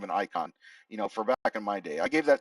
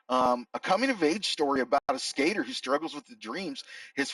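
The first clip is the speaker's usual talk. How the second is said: higher, hammed-up California surfer dude accent, ending lines on "dude"; 6 semitones higher than usual. Reviewing the skater movie for viewers in a bad California surfer accent. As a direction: pitch the whole review like a California surfer kid. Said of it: "a horrible, California surfer dude accent".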